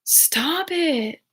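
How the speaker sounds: very high sing-song telling-off; high exasperated pet scold; scolding the cat. Real, not acted. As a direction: chide the cat in a high swooping voice. Scolding her cat mid-stream.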